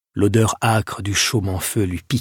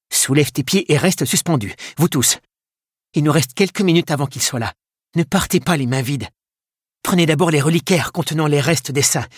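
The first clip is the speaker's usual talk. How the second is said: higher-pitched, pressing instructions; urgent orders; 6 semitones higher than usual. A narrator's reading. Monk organising the escape from the Vikings, urgent orders. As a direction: take charge and direct everyone urgently. Merwin gives orders during raid.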